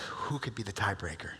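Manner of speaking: hushed whisper, no voice. Retold or imitated. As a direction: whisper it like a secret. Chairman wonders aloud.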